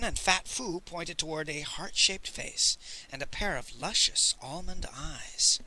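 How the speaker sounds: ordinary delivery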